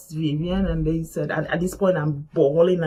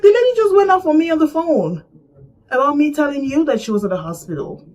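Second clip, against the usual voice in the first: high-pitched, louder aggrieved retelling; indignant protest; 7 semitones higher, 5 dB louder than usual. Retold or imitated. Speaker protests to whoever took the call, indignant. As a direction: launch high into a wronged complaint.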